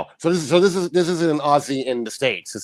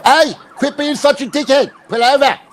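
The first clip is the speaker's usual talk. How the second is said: high-pitched, raised-voice scolding; mock cop yell; 9 semitones higher, 3 dB louder than usual. Host heckling a fleeing driver in a video, mock yell. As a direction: call out a mock order to a runaway driver.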